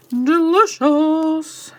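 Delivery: swooning over the smell; relishing. Real, not acted. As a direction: savour the word delicious.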